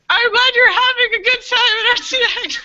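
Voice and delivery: very high, loud, laughing and emotional; choked-up cheer. Retold or imitated. Saying goodbye to the Make-A-Wish family, high and choked up. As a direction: squeak it out through happy tears.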